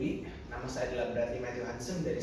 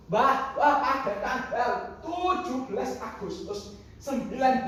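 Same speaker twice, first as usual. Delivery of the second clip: indignant, emphatic lecture; angry scolding; 8 semitones higher, 5 dB louder than usual. Acted. Grandfather scolds grandchild about history, indignant. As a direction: hammer each fact home. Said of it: "Darahku mulai mendidih".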